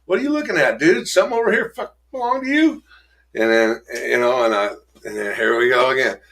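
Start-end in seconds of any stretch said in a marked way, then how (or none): 0.0-2.8 higher, fast bar-room provocation; mock drunken challenge. Drunk bully provokes a bar stranger, higher and fast. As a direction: pick a fight with a stranger. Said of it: "I'll be like".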